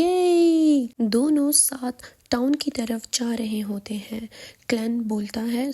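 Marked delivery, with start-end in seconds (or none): 0.0-0.9 high loud squeal of joy; super happy cheer. Acted. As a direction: jump and cheer. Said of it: "super happy ہو کے چیختی ہے". (خوشی سے اونچی چیخ)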